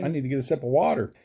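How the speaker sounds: ordinary delivery, nothing marked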